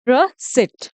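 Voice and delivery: रिसिप्ट drawn out as "ruh... seet"; slow teaching model of रिसिप्ट, "ruh-SEET"; slow silent-p "receipt". Shown as a demonstration. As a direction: break रिसिप्ट slowly in two, no p. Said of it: "इसको बोलेंगे रिसिप्ट".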